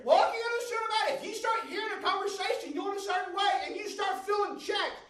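loud, high, fervent preaching; shouting the sermon up to a climax; full-force preaching. Real, not acted. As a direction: preach it out with rising fire.

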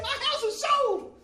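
slow, very high triumphant shout; high-pitched voice. Retold or imitated. Preacher imitating Sandy announcing her sale, high-pitched shout. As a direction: draw it out in loud falsetto. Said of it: "had a real high-pitched voice".

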